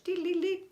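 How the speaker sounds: high, loud sung "dee, dee, dee"; mock magic-spell jingle, way up high; Bewitched magic twinkle. Imitating Bewitched magic sound.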